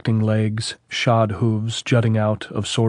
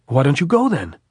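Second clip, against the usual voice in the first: high, loud command; captain barking at the sniper; angry order; 6 semitones higher, 5 dB louder than usual. A narrator's reading. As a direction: order him up the hill.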